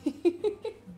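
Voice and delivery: high, light giggle; gleeful giggle. Real, not acted.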